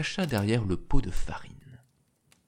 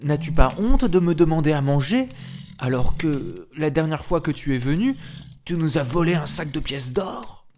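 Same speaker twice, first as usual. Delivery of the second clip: much louder, higher angry telling-off; scolding giantess; 5 semitones higher, 5 dB louder than usual. Acted. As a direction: berate him for stealing. (géante furieuse qui gronde fort)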